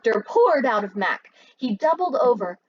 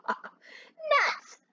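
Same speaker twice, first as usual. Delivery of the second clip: much higher sudden cry; exclaimed; 3 dB quieter than usual. Acted. Lefty exclaims at the news, much higher. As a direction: blurt it out in dismay. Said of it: "exclaimed Lefty".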